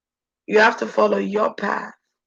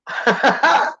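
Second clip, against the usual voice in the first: menacing chuckle; 3 semitones higher than usual. Acted. Laughing after the threat.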